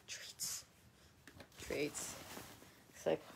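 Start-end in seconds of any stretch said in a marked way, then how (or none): 0.1-2.2 breathy whisper of the word; whispered treats. Real, not acted. Whispering treats to her dog, hushed.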